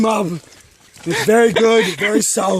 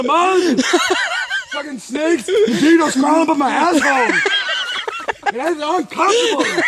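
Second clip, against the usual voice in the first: whiny complaint; 7 semitones higher than usual. Real, not acted. Fed up on hot trail.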